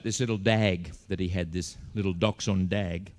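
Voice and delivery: "dag" said for dog, with an "a" sound; swapped vowels. Retold or imitated. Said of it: "the at sound and the ot sound".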